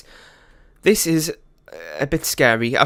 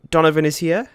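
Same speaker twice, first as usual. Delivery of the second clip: Donovan is here? asked louder, rising on "Donovan" in disbelief; real disbelief; 5 dB louder than usual. Real, not acted. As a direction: ask "Donovan is here?" as if you can't believe it. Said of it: "that was not planned at all".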